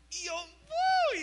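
very high, loud, slow "¡uh!" of glee; high drawn-out gleeful yelp; excited uh. Retold or imitated. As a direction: let out a stretched thrilled yelp. Reaction to being liked back.

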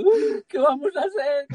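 high, loud tearful wail imitating her; mock a sobbing cry of panic; retold crying. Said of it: "vino así con ellos llorando".

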